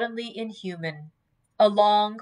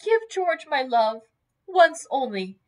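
high, emphatic tearful plea; upset, raised voice on a farewell message; 7 semitones higher, 6 dB louder than usual. A narrator's reading. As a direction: press the message out through distress. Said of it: "the tears poured over her cheeks".

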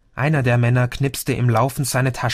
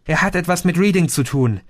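high, hurried, slightly winded; a touch out of breath, fast and high; panting; 6 semitones higher, 3 dB louder, faster than usual. A narrator's reading. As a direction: blurt it out on the run. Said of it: "keuchte Madison".

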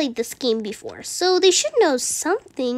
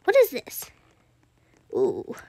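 ordinary delivery

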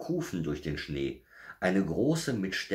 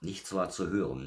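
usual speaking voice

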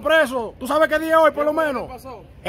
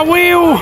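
mock firework whistle; 5 semitones higher, 6 dB louder than usual.

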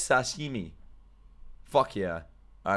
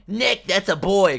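slow, higher, louder mock line; mock quote; 5 semitones higher, 5 dB louder, slower than usual. Streamer describing a character's vibe, slow mock quote. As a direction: toss off a jokey imagined quote. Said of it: "kind of vibes".

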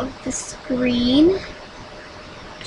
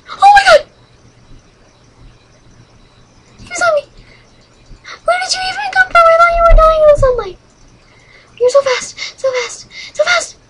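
high, loud, frantic shrieking; real screaming panic, very high-pitched; panicked scream; 6 dB louder, faster than usual. Real, not acted. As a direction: shriek in terror at a sudden attacker. Sudden attack by a game monster.

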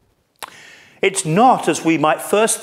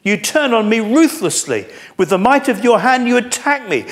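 high, loud, pleading lament; a desperate man shouting his prayer; anguished cry to God; 8 semitones higher, 5 dB louder than usual. Acted. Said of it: "Do you see the anguish of his heart?".

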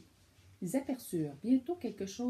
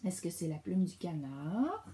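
ordinary delivery

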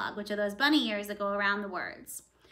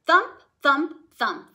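heavy footsteps; 3 semitones higher, 6 dB louder, slower than usual. Acted. Ogre's footsteps approaching.

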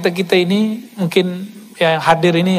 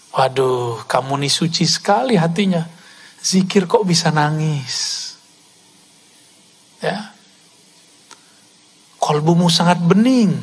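devil's flattering whisper. Acted. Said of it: "Apa kata syaitan?".

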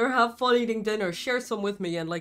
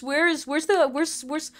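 usual speaking voice

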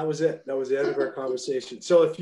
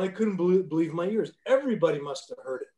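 ordinary delivery

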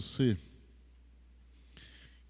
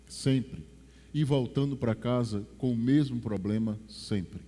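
ordinary delivery

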